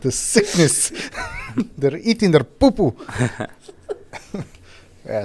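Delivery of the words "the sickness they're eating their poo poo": giggling through the words; laughing. Real, not acted. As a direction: laugh as you say it.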